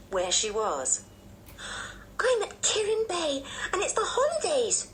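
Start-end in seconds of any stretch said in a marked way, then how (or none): 2.2-4.9 put-on very high, thrilled child voice; overjoyed little girl. A narrator's reading. Anne waking and remembering the holidays, overjoyed high child. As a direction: squeal the words with holiday delight. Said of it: "screwed up her legs with joy".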